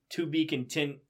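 plain reading voice

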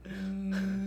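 ordinary delivery

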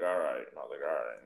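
low, softly dropped voice; subdued retold reply. Teller replaying a terse exchange with bar locals, subdued. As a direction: let it sink low and quiet.

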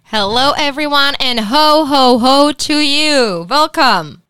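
loud, high jolly Santa-ish welcome; festive greeting. Acted. Co-host welcoming listeners to the Christmas special, jolly. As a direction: ring in the holiday cheer.

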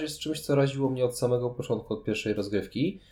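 normal talking voice

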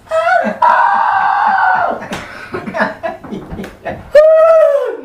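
long high-pitched screams; mock terrified scream. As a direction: shriek as if seeing a ghost, hammed-up. Acting out terror at the ghost.

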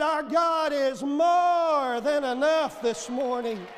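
loud, slow proclamation; preaching shout. Acted. Preacher to the congregation this morning, slow loud proclamation. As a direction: ring it out to the whole church.

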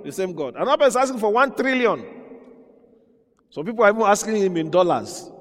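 rising preaching fervor. Real, not acted.

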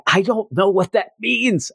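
high-pitched mock outcry; Mister Fantastic. Mister Fantastic while trying to grab him, comic bewilderment. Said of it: "you have Mister Fantastic".

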